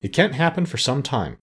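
mild forced cheer; trying to sound cheerful. A narrator's reading. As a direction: attempt a reassuring cheerfulness. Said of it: "trying to speak cheerfully".

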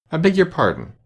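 high, meek apology; very humble. A narrator's reading. Alice apologises to the mouse, very humbly. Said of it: "said Alice very humbly".

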